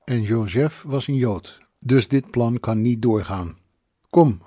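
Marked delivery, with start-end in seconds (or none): 4.1-4.4 loud short call to come; firm summons. A narrator's reading. Pia calling them inside, firm and loud. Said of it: "noodde ze".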